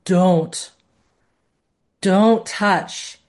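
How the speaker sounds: firm, loud warning with space between words; weighty, commanding warning; felt in the spine. Shown as a demonstration. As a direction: order a child off a hot stove. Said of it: "it has to come from a".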